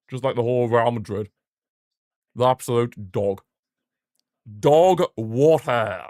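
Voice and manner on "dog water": scornful drawl. Acted. Mocking Real Madrid.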